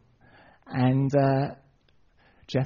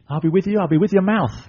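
raised, insistent acted reassurance; exasperated anger; 5 semitones higher, 4 dB louder than usual. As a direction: insist with higher, impatient voice. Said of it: "God actually gets quite angry with him".

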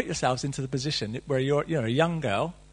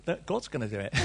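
lower, softer, casual reassurance; offhand Gabriel; 5 semitones lower, 8 dB quieter than usual. Retold or imitated. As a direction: shrug it off casually. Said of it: "He says".

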